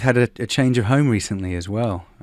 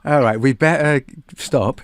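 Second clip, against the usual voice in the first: slow put-on British English accent; host slips into a British accent; host copying the guest in a British accent; mock British accent; 4 semitones higher, 4 dB louder, slower than usual. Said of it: "a funny accent, it's hard to stop".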